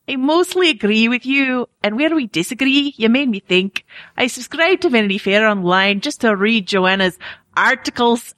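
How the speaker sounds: shaky amateur Scottish brogue; put-on Scottish, slipping as it goes; Scottish accent. Shown as a demonstration. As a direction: read it out in a badly faked Scottish accent. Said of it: "One is in a Scottish accent".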